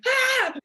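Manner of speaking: high, loud yelp of fright; startled scream. Retold or imitated. Startled by a giant man at the door, loud scream. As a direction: scream as if caught off guard.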